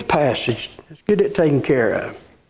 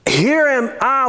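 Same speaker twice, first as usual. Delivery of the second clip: higher, eager "Here am I"; exclaimed; 6 semitones higher than usual. Shown as a demonstration. Preacher repeating Isaiah's eager reply, exclaimed. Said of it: "with an exclamation mark".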